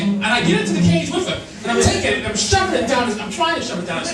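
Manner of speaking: usual speaking voice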